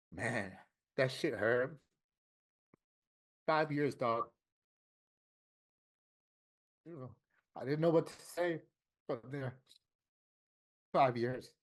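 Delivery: choked up, long halting pauses; real tearful hurt. Real, not acted. Man recalling his doctor's five-year prognosis, tearful. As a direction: struggle to get the words out through tears. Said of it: "all this crying shit".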